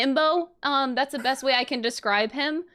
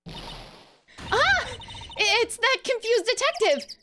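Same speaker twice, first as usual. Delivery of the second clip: put-on excited girl voice. Voices game heroine.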